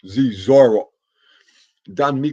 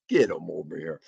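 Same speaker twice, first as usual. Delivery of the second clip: ordinary talk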